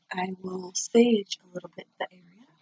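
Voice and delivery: normal talking voice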